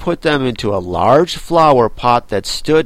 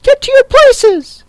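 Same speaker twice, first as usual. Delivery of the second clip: very high, loud Queen's shriek; piercing royal command; thundering shout; 10 dB louder than usual. A narrator's reading. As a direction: scream the order at everyone. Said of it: "in a voice of thunder".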